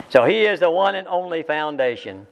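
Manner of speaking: ordinary talk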